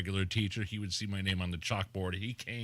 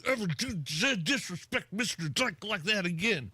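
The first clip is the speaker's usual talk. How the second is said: high mock scolding, broken up by jolts; stuttered, high-pitched telling-off; scolding teacher; 10 semitones higher than usual. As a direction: imitate an irate teacher rattling two pupils. Replays teacher scolding two boys.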